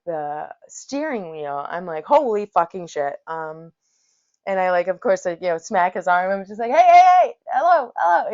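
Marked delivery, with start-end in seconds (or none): 2.0-3.2 loud startled curse; shocked outburst of alarm. Retold or imitated. Passenger seeing driver's head hit wheel, loud alarmed curse. 6.7-8.3 much higher, loud urgent calls; calling out. Retold or imitated. Passenger yelling to wake the sleeping driver, loud and high.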